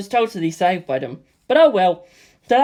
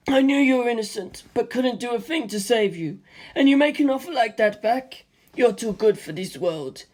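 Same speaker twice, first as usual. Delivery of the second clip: Stephen, moved; 5 semitones higher than usual. A narrator's reading. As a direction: let gratitude fill the voice. Stephen moved, thanking George.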